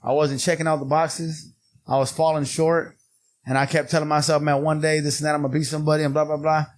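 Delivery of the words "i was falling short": ordinary delivery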